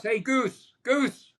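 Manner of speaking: high-pitched calling out across a room; retold yell. Catcher calls across the clubhouse to a teammate, mock yell. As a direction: imitate a ballplayer hollering for a teammate. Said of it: "And he yells across".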